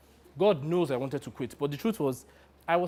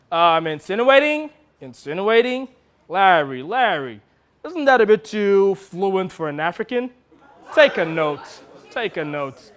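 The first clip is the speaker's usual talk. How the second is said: raised, querying director's objection; interrupting director; 3 semitones higher, 5 dB louder than usual. Acted. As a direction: pounce on the word as a fussy director. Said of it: "jumped in with a glorious observation".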